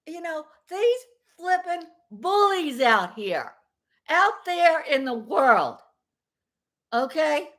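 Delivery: ordinary talk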